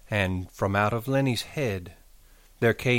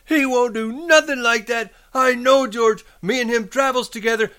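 high, loud, panicked insistence; frantic; 5 dB louder than usual. A narrator's reading. Lenny insisting on George's friendship, frantic high voice. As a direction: protest desperately, speeding up at the end. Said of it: "Lenny cried frantically".

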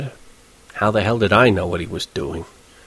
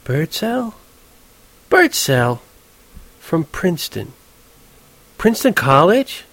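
high woman's voice, puzzling aloud; pondering, with dawning recognition; mulling it over; 7 semitones higher, slower than usual. A narrator's reading. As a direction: try the name out questioningly. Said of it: "running the name over in her mind".

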